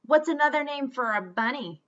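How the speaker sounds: mocking playground bully voice; knowing taunt. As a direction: bait him with a sly grin. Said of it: "they asked knowingly".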